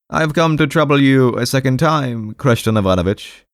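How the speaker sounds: high-pitched, deferential petitioner; timid patient. Acted. Timid patient addresses his doctor.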